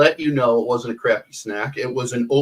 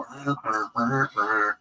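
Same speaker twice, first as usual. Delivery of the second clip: muffled wah wah talk; 6 dB quieter, slower than usual. Retold or imitated. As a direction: mimic the Charlie Brown teacher's muffled drone. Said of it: "sound like the teacher from Charlie Brown".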